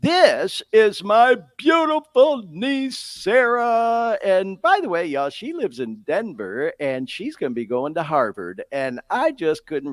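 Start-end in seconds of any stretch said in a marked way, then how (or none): 0.0-4.2 big proud announcer-style intro, higher and slower; hyped introduction. Real, not acted. Uncle proudly introduces his niece to listeners, hyped. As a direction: present her like a star.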